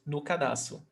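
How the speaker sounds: soft "No" barely heard before "cadarço"; unstressed "No". Shown as a demonstration. Teacher repeats the word you almost don't hear, "No" unstressed. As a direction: let "No" almost disappear, softly, into "cadarço". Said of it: "You almost don't hear it".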